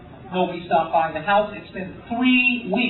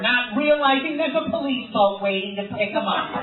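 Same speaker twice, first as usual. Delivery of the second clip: hammed-up crime show narration; angry TV narrator; 2 semitones higher than usual. Crime show narrator mocking a comedian who missed jury duty, hammed-up indignation. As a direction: narrate it like an irritating, judgmental TV host. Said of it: "angry guy narrating my story".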